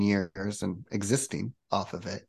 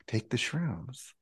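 much softer, slow inner prompt; 3 semitones higher, 9 dB quieter, slower than usual. Retold or imitated. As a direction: let it float up quietly from within. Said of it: "not outside voice".

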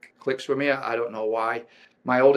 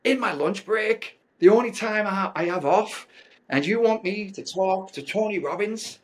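higher, incredulous whine at the timing; exasperated complaint; 9 semitones higher, 4 dB louder than usual. Retold or imitated. Husband protesting to wife about the call time, exasperated. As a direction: protest like it's the worst time possible.

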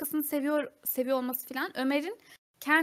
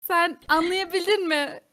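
louder, slow, pointed question; emphatic auntie address; 4 semitones higher, 6 dB louder than usual. Acted. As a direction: spell it out loudly to the aunties. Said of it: "Bakın şu an bu oluyor".

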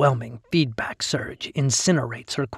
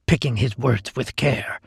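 usual speaking voice

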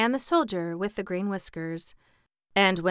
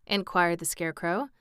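plain reading voice

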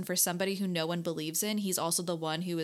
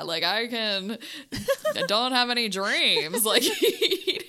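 louder, cracking up mid-quote; laughing; 3 semitones higher, 6 dB louder, slower than usual. Real, not acted. Joking that Goldblum wouldn't scare kids, laughing loudly. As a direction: laugh through the punchline.

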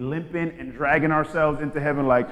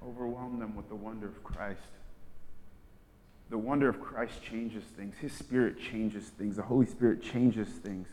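much softer and lower, reverent; softly, reverently; hushed conviction; 7 semitones lower, 11 dB quieter than usual. Real, not acted. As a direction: let it settle in a hush. Quiet preaching moment.

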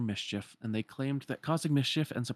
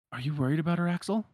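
put-on higher boyish voice, a touch louder; concerned Roxas; 6 semitones higher, 4 dB louder, faster than usual. Roxas asking Axel about Shion, lightly higher voice. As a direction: ask gently but directly.

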